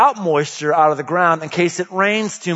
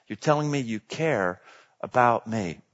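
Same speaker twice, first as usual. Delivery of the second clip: normal talking voice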